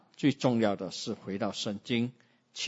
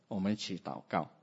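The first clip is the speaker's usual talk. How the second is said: ordinary talk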